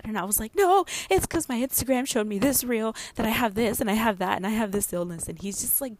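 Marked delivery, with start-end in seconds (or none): 0.5-5.3 loud, high-starting insistence; worked-up protest. Retold or imitated. Wife insisting to husband that she is ill, worked-up. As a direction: argue back in mock panic, loudly.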